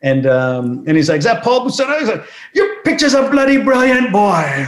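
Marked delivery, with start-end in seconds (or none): 1.2-4.6 much higher, posh-sounding English accent imitation. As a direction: put on Robert Kennedy's English accent, way up high. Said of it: "a staunch British accent, or English accent".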